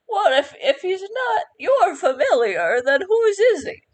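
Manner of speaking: put-on high, quavering old-lady question; old woman voice. Old shopkeeper asks whose familiar the bird is, high and curious.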